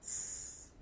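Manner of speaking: the letter "S" as a sustained voiceless hiss; snake-like s. Shown as a demonstration. Teacher sounding out a Russian letter for learners, snake hiss. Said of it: "Like what the snake says".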